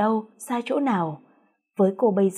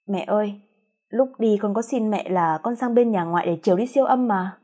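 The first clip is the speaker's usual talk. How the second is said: usual speaking voice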